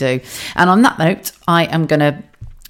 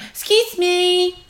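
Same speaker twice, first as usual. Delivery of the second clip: high, slow, put-on politeness; posh polite voice; much slower than usual. Asking the BT man, in a high slow polite voice. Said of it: "like you do".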